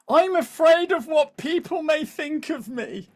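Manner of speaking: high, slow, timid voice of a nervous poster; mock fearful. Coach voicing the audience's fear of posting, high and timid. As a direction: put on a worried little voice for effect.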